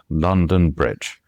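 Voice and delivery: "London Bridge" slow and measured, falling. Shown as a demonstration. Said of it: "a little bit slower".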